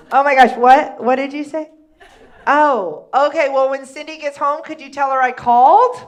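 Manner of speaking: loud startled phone voice; surprised teen on phone. Retold or imitated. Teen girl realizing she's talking to Cindy's mom, loud surprise. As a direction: suddenly caught out by the wrong person.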